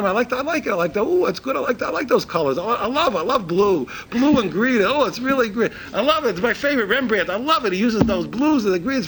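high-pitched mock gushing; clueless museum lump. Lump at the museum liking the colors, naively gushing. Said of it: "we're, we're lumps".

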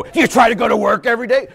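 high, loud incredulous question; mock scolding challenge. Preacher challenging the excuse-maker, loud mock scolding. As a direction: hammer the question at them.

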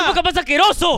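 hyped podcast slogan cry; catchphrase shout. Acted. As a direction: call back the tagline with energy. Answering catchphrase chant.

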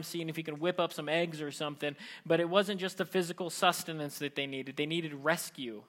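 ordinary delivery

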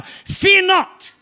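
high, loud proclamation; God's command. Acted. Preacher voicing God to a fearful congregation, commanding shout. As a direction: call it out as God's bold command. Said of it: "God's saying to you right now".

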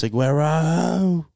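loud, high, drawn-out football call; mock commentator's goal call. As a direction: stretch the name out like a goal commentator. Mimics famous goal commentary call.

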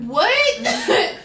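high laughing What; high, loud laughing What; laughing disbelief. Real, not acted. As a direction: laugh out the word in shock.

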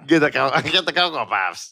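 lightly put-on squeezed voice; cereal-fetching bit. Friend echoing the supermarket cereal bit, lightly squeezed voice. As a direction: join in the silly cereal imitation. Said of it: "get cereal in the supermarket".

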